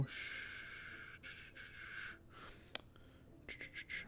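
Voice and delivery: whispered shushing, soothing; long quiet shh breath. Real, not acted. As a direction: hush a scared animal gently. Soothing the pet again.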